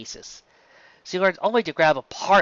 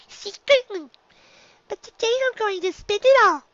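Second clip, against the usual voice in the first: put-on young filly voice. A narrator's reading. Dinky answering her teacher in a high filly voice. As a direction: play a small shy filly.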